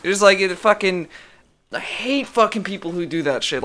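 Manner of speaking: ordinary talk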